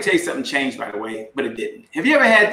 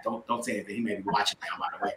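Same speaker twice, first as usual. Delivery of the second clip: dropped low and soft, half-secret; hushed joking aside; 9 semitones lower, 13 dB quieter, faster than usual. Real, not acted. Speaker hushes the audience about his brother watching, softly. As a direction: confide it softly so he won't hear.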